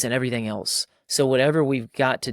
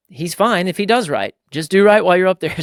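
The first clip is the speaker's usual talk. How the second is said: high, loud call up into the tree; calling up to son; 7 semitones higher, 10 dB louder than usual. Retold or imitated. Dad calling up to his son climbing a tree, high and loud. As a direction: project up to a boy high in the branches.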